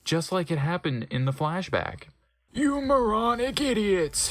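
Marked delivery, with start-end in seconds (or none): 2.5-4.3 hammed-up evil lord yelling; over-the-top dark lord ranting at his servants; angry villain shout. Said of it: "he shooted angstily".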